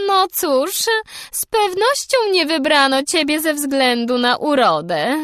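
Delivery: high, loud and cutting; biting remark. A narrator's reading. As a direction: sneer it down at the girl. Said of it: "kąśliwą uwagę".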